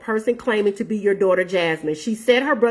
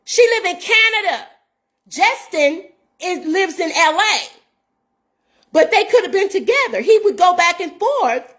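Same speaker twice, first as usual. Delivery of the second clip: agitated outburst; 6 semitones higher than usual. Real, not acted. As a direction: pitch rising, pushing the words out upset. Upset rant about child's safety.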